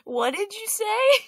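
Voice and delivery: high, loud, sharply rising query; exaggerated baffled "what did you say?"; mock incredulous question. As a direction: act as if you missed every word. Acting the heckler who cannot hear.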